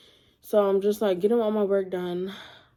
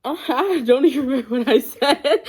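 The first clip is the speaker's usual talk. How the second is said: laughing through the words; can't get it out for laughing; a real laugh of disbelief; 4 semitones higher, 5 dB louder than usual. Real, not acted. Laughs at forgetting what she said.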